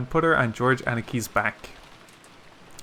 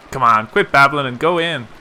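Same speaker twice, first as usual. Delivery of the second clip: loud, brusque order; impatient urging; 8 dB louder than usual. A narrator's reading. Cousin urging babbling friends into the guest house, impatient and loud. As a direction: hurry them along loudly. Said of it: "being urged on by Jessica".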